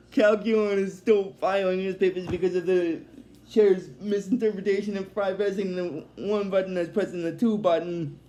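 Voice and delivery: normal talking voice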